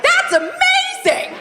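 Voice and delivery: high, slow gushing "That's amazing!"; mock amazed. Teasing the audience's applause, high mock gush. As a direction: gush with fake delight, laughing. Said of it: "You were like".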